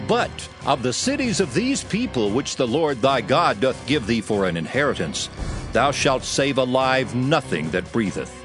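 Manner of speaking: usual speaking voice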